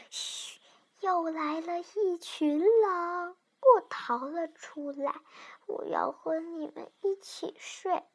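put-on soft, slow, secretive child; shush, then confide very quietly; small hushed voice. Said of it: "我小声地说".

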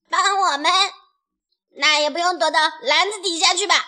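loud, high, quick retort; indignant. A narrator's reading. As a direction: scoff with mild outrage. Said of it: "贝里奥愤愤地说".

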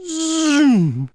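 very high zooming whoosh; loud zoom. A narrator's reading. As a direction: fling out a rising zoom as they race off. Dragonflies race off.

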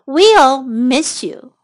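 WE'LL MISS YOU stretched out word by word; slow model phrase. Shown as a demonstration. Teacher repeats the phrase for learners, slowly. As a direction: give each word time for learners to copy.

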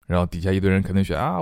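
ordinary talk, nothing marked

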